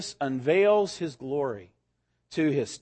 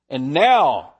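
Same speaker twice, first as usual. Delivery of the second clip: noticeably louder with a faint growl in it; louder, hint of roar; 8 dB louder than usual. A narrator's reading. Aslan calling out the unbelieving dwarf, louder with a hint of roar. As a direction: Aslan raising his voice at the doubting dwarf, a touch of roar. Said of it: "in a much louder voice with just a hint of a roar".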